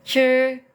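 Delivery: "Sure" as Mandarin zhi, held high and flat; teacher's demo of "Sure" on one high level tone; "Sure" drawn level, tongue curled; level first-tone zhi. Shown as a demonstration. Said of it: "dia nada satu jadi datar".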